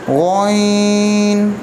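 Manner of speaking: गोइन held long; long drawn गोइन; lengthened letter. Shown as a demonstration. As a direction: stretch out गोइन slowly. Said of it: "इसको लंबा करके पढ़ना है".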